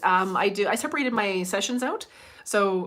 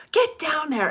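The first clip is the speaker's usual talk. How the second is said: higher, louder mock yell; mom yelling; 7 semitones higher, 6 dB louder, slower than usual. Mom yelling at her daughter Julie, imitated holler. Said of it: "she'd be yelling at her".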